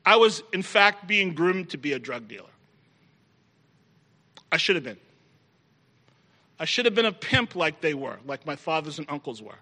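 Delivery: usual speaking voice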